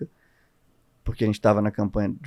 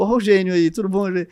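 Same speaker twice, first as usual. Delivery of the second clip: teasing greeting, pitched higher; mock greeting; 7 semitones higher, 4 dB louder than usual. Coworkers teasing him as he walks in, mock greeting. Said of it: "toda vez que eu chego".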